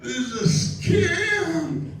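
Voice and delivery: high, very slow, laughing realization; stunned, laughing outrage; retold revelation. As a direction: drag it out like the penny just dropped. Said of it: "I go".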